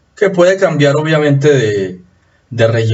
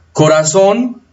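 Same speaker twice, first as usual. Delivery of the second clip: "Corazón" pitched up, rising to a stressed final syllable; stress on last syllable; 7 semitones higher, slower than usual. Shown as a demonstration. Teacher showing students the prosodic accent in "Corazón", stressed final syllable. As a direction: lift the "zón" of "Corazón" high. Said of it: "tiene acento prosódico".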